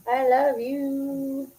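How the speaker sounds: high, very drawn-out cooing; doting coo. Real, not acted. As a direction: stretch it out high, cooing to a pet. Repeated as cooing to pet.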